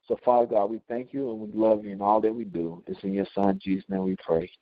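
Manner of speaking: low-pitched, steady prayer voice; closing prayer. Real, not acted. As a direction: lead the group in earnest prayer.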